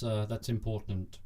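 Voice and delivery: ordinary talk, nothing marked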